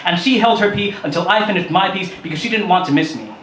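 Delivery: usual speaking voice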